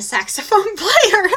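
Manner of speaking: laughing through the words, slowed down. Real, not acted. Mom laughing while recalling dancing on a saxophone player. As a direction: giggle it out as the memory hits.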